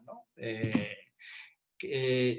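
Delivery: normal talking voice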